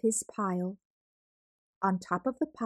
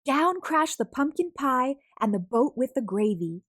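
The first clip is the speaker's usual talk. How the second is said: brisk, louder and higher as the pile tumbles; excited storytelling; 5 semitones higher, 4 dB louder than usual. A narrator's reading. Narrator excitedly telling how the food tower crashes down. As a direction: build the thrill of the crash, a bit louder.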